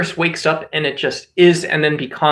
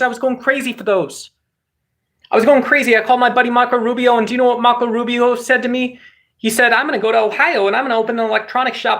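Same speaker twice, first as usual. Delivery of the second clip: higher-pitched, run-on absurd rant; ranting character; 6 semitones higher, faster than usual. Acted. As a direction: rattle off grievances in a higher, insistent voice.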